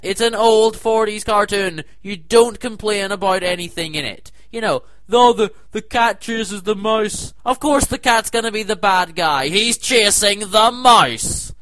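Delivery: lecturing the complainers, loud and heated; heated rant. Real, not acted. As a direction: keep ranting hard. Continued ranting.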